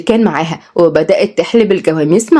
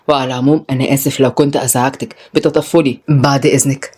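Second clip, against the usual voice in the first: low, restrained male voice; offended young man; 5 semitones lower than usual. Acted. Tutor excuses himself after being misjudged, low and reserved. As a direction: keep it low and stiffly polite.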